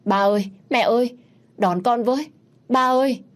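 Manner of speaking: high, loud whimpering call for his parents; a small boy crying out, on the verge of sobbing; little boy sobbing into the phone to his father; tearful child's cry. A narrator's reading. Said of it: "giọng mếu máo kêu lên".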